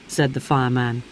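ordinary delivery

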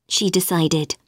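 ordinary delivery, nothing marked

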